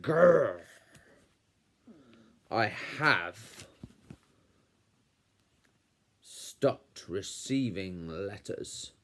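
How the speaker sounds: growl, pause, then pronounce it slowly; snarling villain uncle. A narrator's reading. Snarling uncle answers his nephew.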